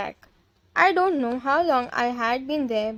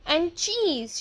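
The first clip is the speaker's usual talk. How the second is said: ordinary delivery, nothing marked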